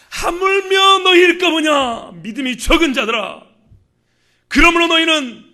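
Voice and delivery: high, very slow preaching cry; declamatory shout. Acted. Preacher cries out Jesus' words to those of little faith, declamatory shout. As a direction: shout the scripture out, stretching each phrase.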